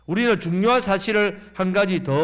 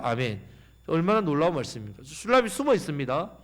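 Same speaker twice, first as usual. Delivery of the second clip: ordinary talk, nothing marked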